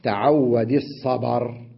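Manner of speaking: الصبر as as-sabar, stopping on ر; الصبر with open ba and silent ending; pause with vowel transfer. Shown as a demonstration. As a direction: slowly, move the a of الصبر onto the ب. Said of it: "أن تنقل حركته لما قبله".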